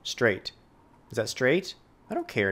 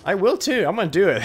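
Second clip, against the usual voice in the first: mock threat; 4 semitones higher, 6 dB louder, faster than usual. Real, not acted. Loud hyperbole.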